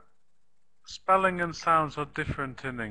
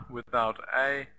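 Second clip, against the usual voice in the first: ordinary delivery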